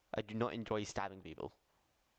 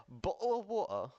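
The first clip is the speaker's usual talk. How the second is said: higher British-accented demo; put-on British accent; 10 semitones higher, 4 dB louder than usual. Shown as a demonstration. Australian streamer showing chat a British version, put-on British accent. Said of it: "I mean, I could say".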